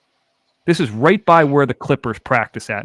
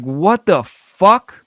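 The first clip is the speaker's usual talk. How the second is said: slow, drawn-out disbelief; slow stunned exclamation; 6 semitones higher, 5 dB louder, much slower than usual. Retold or imitated. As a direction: stretch out the shock. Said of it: "I was like".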